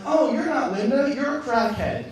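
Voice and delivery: loud, high outburst of realisation; alarmed realisation. Acted. Driver realising the stranger isn't Linda, alarmed outburst. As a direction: blurt it out as it dawns on you.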